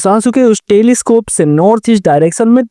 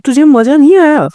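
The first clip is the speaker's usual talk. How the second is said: astonished question; 8 semitones higher than usual. Acted. Grandpa astonished at Sasuke.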